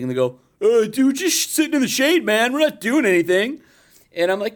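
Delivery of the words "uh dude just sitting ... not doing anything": high, whiny-slacker teen imitation; teenager. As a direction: protest like a put-on teenage dude. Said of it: "I see ten teenagers sitting".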